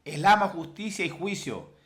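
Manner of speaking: loud, high declamation of a verse; forceful preacher's proclamation; proclaiming scripture. Acted. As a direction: ring the words out over the congregation.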